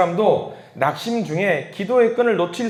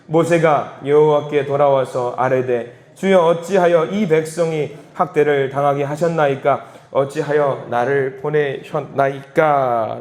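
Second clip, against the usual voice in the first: slow, measured verse reading; slow scripture reading; 3 semitones lower, slower than usual. Shown as a demonstration. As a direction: read the verse aloud slowly, stretching the ending. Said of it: "천천히 다시 한번 읽어볼게요". (성경을 천천히 또박또박 낭독하는 말투)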